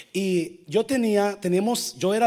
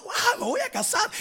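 excited. Retold or imitated. Retold excited friend quote.

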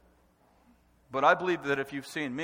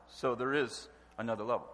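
normal talking voice

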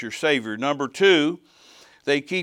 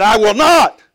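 high, very loud defiant roar; roared demon defiance; 8 semitones higher, 14 dB louder, slower than usual. Retold or imitated. Demon in a tiny lady roaring back at preacher, defiant roar. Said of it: "this man's voice roared back at me".

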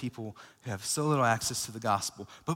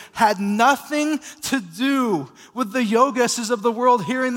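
louder, higher impassioned sermon; preaching at full force; 7 semitones higher, 8 dB louder than usual. Acted. Pastor urging the church to reach the unreached, impassioned preaching. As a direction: drive it home to the church.

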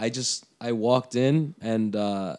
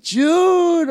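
loud, very high drawn-out name call; excited shout; 9 dB louder than usual. Retold or imitated. Greeting friend not seen in nine years, excited shout. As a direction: holler a long-lost friend's name.

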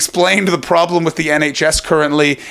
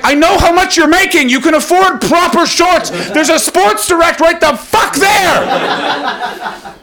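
very high-pitched, exasperated shouting; hammed-up high yell, stretched out at the end; exasperated rant; 14 semitones higher, 3 dB louder, slower than usual. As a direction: scream it like you've been driven crazy. Drives me fucking crazy.

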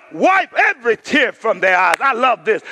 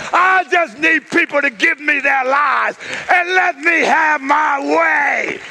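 full-force preaching; 5 semitones higher than usual. Acted. Pastor preaching to the church, calling out at full force. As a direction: preach it hard to the congregation. Preacher voices God, still shouting.

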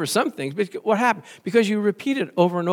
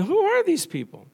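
mock surprise; 13 semitones higher, 4 dB louder than usual. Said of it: "I'm going".

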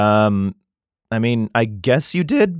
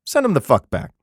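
mock king; 4 dB louder than usual. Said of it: "the king being like".

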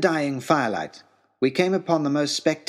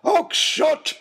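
a much higher, loud bellowed name; 3 dB louder than usual. Acted. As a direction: bellow the name across the camp. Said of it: "and bellowed".

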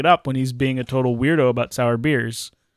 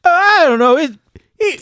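high, loud, whiny put-on voice; Johnny Bruja impression; 10 semitones higher, 8 dB louder than usual. Guessing how Johnny would answer, high whiny put-on voice. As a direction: whimper it like a helpless Johnny. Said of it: "I thought you were gonna say".

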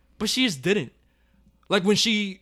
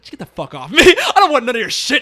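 louder, laughing brush-off; mock angry wife; 6 semitones higher, 8 dB louder than usual. Imitating the wife rejecting her husband, laughing loud brush-off. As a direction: shove him away with laughing scorn. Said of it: "She's like".

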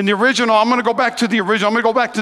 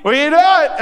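wife calling the son from the yard, raised voice; 5 semitones higher than usual. Retold or imitated. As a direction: catch the kid in the act. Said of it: "she's like".

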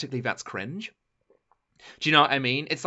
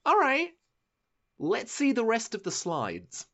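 high-pitched mock willingness; reserved judgement; 7 semitones higher than usual. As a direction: play someone reluctantly giving it a chance, higher. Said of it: "I was like".

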